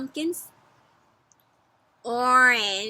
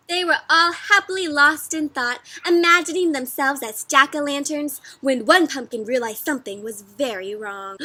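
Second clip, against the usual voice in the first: story narration; 3 semitones higher, 6 dB louder than usual. Acted. Reads the book text aloud.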